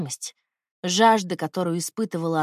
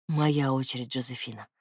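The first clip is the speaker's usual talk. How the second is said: hoarse whisper; 7 semitones lower, 4 dB quieter than usual. Acted. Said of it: "хрипло прошептал он".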